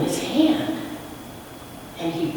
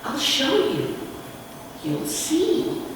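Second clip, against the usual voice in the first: a touch higher, gently promising; lightly voiced stranger; 8 semitones higher, 3 dB quieter, slower than usual. A narrator's reading. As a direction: quietly promise he'll prove it. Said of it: "And he said".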